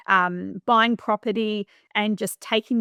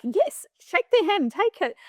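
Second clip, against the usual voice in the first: excited urging; 10 semitones higher than usual. Retold or imitated. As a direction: re-enact an emphatic yes, pitched well up and slowed. Urging partner to grab bargain.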